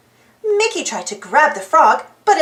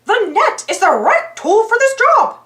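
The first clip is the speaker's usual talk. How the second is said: high, cheerful mouse voice; bright and eager, like a cartoon hero; Mickey voice; 7 semitones higher than usual. A narrator's reading.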